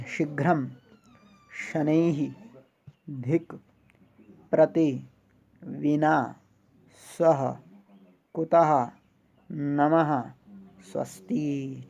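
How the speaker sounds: one word at a time, drawn out with long pauses; pronunciation demonstration. Shown as a demonstration. Teacher showing students how to say Sanskrit words, careful pronunciation demonstration. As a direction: model each word from शीघ्रम to स्वस्ति distinctly, stretching the syllables and sounding the final breath in नमः. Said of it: "इनको भी उच्चारण करना सीखना है".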